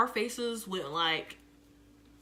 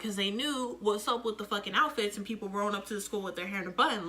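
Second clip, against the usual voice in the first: usual speaking voice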